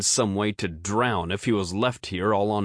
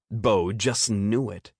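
ordinary delivery, nothing marked

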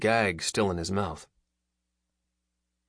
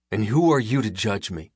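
demanding; 3 semitones higher, 6 dB louder than usual. A narrator's reading. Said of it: "he demanded".

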